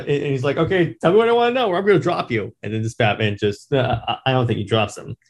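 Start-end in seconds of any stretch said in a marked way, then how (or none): 0.6-2.5 higher, fast threatening voice; menacing threat. Retold or imitated. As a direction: rush the threat, pitched up.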